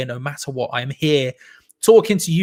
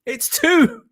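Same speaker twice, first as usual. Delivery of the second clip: very high, breaking into a laugh; real goal excitement; 5 dB louder, slower than usual. Real, not acted. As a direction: cry out in disbelieving joy at the goal. Live reaction to a goal.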